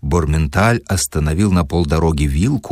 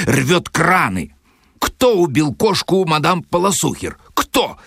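rising, higher indignant scolding; angry tirade; 6 semitones higher than usual. Acted. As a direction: build the outrage up to the top. Professor berates Sharikov. (профессор отчитывает Шарикова, всё выше и гневнее)

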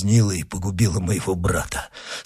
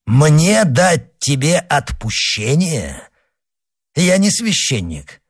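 scornful cry; 6 semitones higher than usual. A narrator's reading. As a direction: throw the question back at him, pitch soaring. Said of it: "вскричал монах со смехом".

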